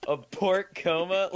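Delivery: slow, high, disgusted question; grossed out. Real, not acted. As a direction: repeat it in disbelief. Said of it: "that's gross".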